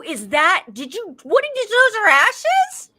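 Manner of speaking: high-pitched, loud panicked questioning; retold shrieking question, stretched out at the end; retold horror. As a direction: re-enact appalled disbelief, voice climbing. Retells horrified questioning of husband.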